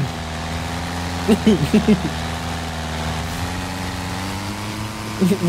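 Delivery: long low laugh. Real, not acted. Laughing at bad flying.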